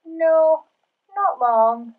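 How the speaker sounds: put-on loud, high, drawn-out childlike reply; called-back refusal. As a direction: call it back from another room. Pig calls back a refusal.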